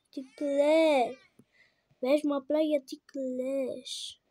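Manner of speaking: loud, drawn-out plea; exasperated demand. Real, not acted. Player asks crying baby why, loud exasperation.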